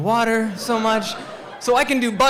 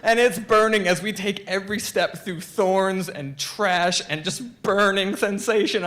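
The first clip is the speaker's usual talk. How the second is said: usual speaking voice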